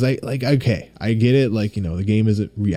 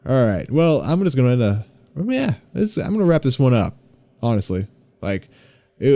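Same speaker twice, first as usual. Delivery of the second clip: ordinary talk, nothing marked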